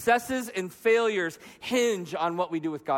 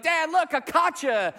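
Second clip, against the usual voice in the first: put-on high-pitched excited child showing Dad; excited kid; 6 semitones higher than usual. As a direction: imitate a kid thrilled with a prize. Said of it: "they were just so excited".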